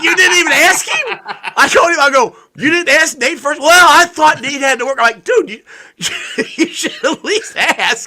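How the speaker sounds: high, loud, laughing incredulity; laughing disbelief. Retold or imitated. Scolding his buddy for not asking his son first, high laughing disbelief. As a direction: scold him through laughter, can't believe it.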